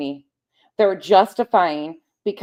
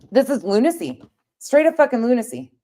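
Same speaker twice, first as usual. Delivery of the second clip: worked up; 7 semitones higher than usual. Real, not acted. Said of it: "I'm getting worked up".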